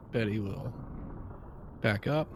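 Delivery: ordinary talk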